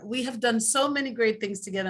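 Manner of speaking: ordinary delivery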